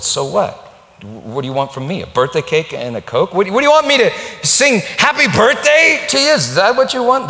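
rising sneer, building to a loud shout; scornful prostitute mocking her friend; mock harsh shout; mocking retort. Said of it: "A second voice said".

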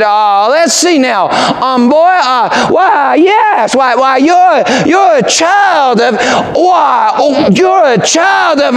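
high, slow, drawn-out musing; pondering preacher. Retold or imitated. Preacher studying the boy before the church, slow musing. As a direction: stretch the suspense, searching.

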